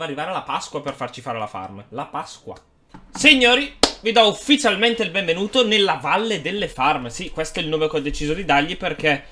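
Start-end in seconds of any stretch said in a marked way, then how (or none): 3.1-7.1 loud, high grand welcome; excited announcement. Real, not acted. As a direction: announce it like a showman. Grand welcome to the farm valley.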